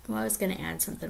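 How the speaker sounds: ordinary delivery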